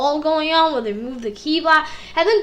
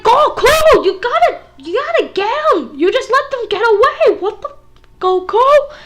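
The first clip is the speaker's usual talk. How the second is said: higher, louder mock scolding; mock angry Beerus; 7 semitones higher, 5 dB louder than usual. Beerus scolding Goku, mock angry and higher. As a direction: act out Beerus chewing Goku out. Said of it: "Beerus is mad".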